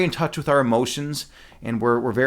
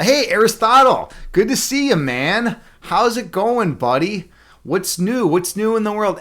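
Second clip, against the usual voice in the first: excited greeting; 7 semitones higher, 4 dB louder, slower than usual. Real, not acted. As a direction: greet him like you're thrilled he showed up. Greeting a friend arriving in chat.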